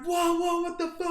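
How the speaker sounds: high-pitched mock alarm; retold alarm. Prankster retelling his shock at the drawn gun, retold alarm. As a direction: react high and startled, acting it out. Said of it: "And I was like".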